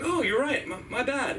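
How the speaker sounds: high-pitched mock backing down; comic flustered concession; sheepish apology. Acts out sheepish apology to stranger.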